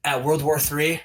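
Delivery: menacing wrestler's challenge; wrestling promo. Acted. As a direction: cut a measured promo, dropping low at the ends.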